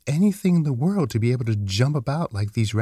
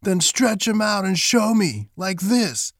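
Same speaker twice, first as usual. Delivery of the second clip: high-pitched challenging rabbit; wild rabbit; 9 semitones higher than usual. A narrator's reading. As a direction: dare him in a high storybook rabbit voice. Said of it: "said the wild rabbit".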